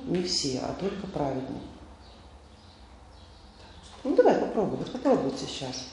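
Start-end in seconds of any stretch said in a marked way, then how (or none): none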